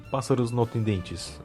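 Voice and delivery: normal talking voice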